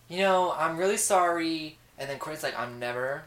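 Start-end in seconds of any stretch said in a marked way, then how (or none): 0.1-1.8 mock apology. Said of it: "Voices were spot on". 2.6-3.2 mock sulk. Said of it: "Voices were spot on".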